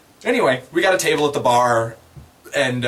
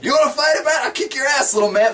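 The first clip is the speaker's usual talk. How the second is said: goblin taunt; 13 semitones higher, faster than usual. Retold or imitated. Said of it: "and he's like".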